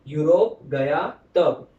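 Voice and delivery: slow emphatic recital. Shown as a demonstration. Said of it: "I'll repeat".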